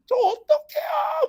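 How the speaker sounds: high, slow, loud wailing sob; mock crying. Teacher imitating a crying student on the phone, slow high wail. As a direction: drag the words out, wailing like a distraught kid. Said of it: "막 하면서 막 우는 거야".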